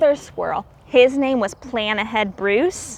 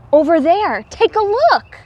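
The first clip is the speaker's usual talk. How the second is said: excited discovery; 6 semitones higher, 5 dB louder than usual. A narrator's reading. Discovery in the story.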